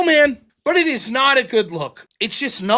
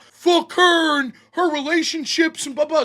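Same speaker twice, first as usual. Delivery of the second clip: mock angry rant; 6 semitones higher than usual. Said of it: "What did her best friend Dusty do".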